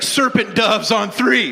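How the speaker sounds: loud high team huddle chant; coach's huddle call. Retold or imitated. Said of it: "He's like".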